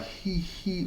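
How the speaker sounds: high-pitched "hee hee" imitation; stuck, odd laugh. Re-enacting the laugh that came out wrong, high airy hee-hee. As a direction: squeak out two separate high hees. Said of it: "I went something like".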